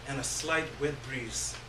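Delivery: plain reading voice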